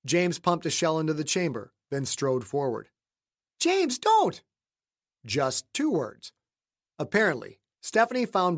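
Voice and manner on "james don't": higher, sharp cry to stop him; pleading wife. Acted. Wife begging James not to go out, pleading.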